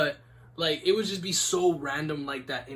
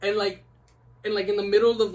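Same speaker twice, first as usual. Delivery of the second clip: ordinary delivery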